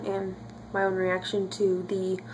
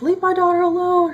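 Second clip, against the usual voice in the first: high, loud mock dramatic scream; imitating a scream; 10 semitones higher, 6 dB louder than usual. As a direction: ham up Carlisle's protective yell. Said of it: "Carlisle screams".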